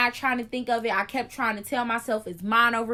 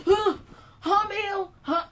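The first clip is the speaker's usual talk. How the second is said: high, laughing mock-confused huhs; groggy friend; 9 semitones higher than usual. Friend jolts awake, high, mock-confused and laughing. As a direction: play the startled half-asleep friend.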